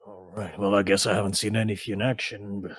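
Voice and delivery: subdued, reserved tavern friend; sceptical friend. Acted. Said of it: "He's like".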